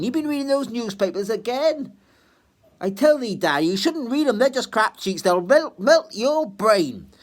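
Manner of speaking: scolding daughter. Acted. Meg scolds her father about newspapers, high and loud. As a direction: scold dad for the papers.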